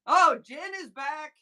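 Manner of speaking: light up as a friend returns; happy surprise. Real, not acted. Welcoming Jen back to the chat, happy shout.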